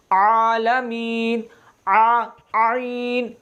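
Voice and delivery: आलमीन and आइन stretched very slowly, ain pressed in the throat; drawn-out ain. Shown as a demonstration. Teacher showing how ain sounds in आलमीन, very slow. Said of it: "ये इस तरह अदा होगा".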